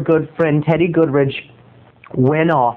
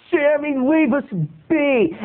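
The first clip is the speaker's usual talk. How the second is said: mock pleading protest; 8 semitones higher, slower than usual. Boys protesting to bully.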